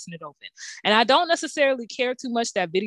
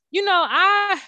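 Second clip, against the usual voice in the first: ordinary talk, nothing marked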